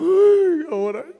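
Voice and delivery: loud, high-pitched imitated wail; exaggerated weeping wail; mock crying. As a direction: break into a hammed-up sob. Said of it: "Me llamó llorando y me dijo".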